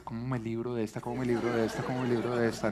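soft, sly thinking through a laugh; scheming child. Retold or imitated. Child's inner voice plotting to escape punishment, softly laughing. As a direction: mutter it like a boy plotting an escape. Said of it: "Yo sé que dentro del cerebro está, es".

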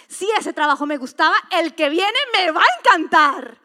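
fervent sermon cry; full-force preaching. Acted. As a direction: shout the promise to the congregation.

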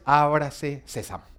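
slow, mock-mysterious incantation of "ábrase sésamo"; mock magic spell. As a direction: intone "ábrase sésamo" slowly like a conjurer's spell. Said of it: "Esto no es". (recitado lento como conjuro mágico de burla)

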